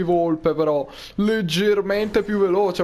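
ordinary talk

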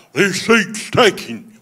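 mock kid with an Alabama drawl; Mississippi Alabama accent. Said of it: "That was my Mississippi, Alabama accent".

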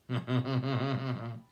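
string of quick laugh pulses; real chuckle. Real, not acted. Teacher amused while talking to pupils, real chuckle. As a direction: chuckle in a steady run.

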